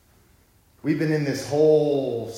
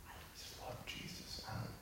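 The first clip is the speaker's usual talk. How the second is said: whisper; 19 dB quieter than usual. Retold or imitated. Friends answering simply, whispered.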